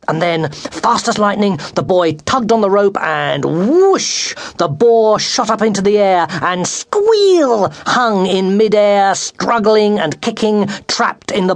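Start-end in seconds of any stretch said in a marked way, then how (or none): 3.5-4.3 high, rising whoosh; mimicked whoosh. A narrator's reading. Acts out rope's sudden whoosh. 6.7-7.7 loud, high boar squeal; mimicked squeal. A narrator's reading. Acts out the boar's squeal.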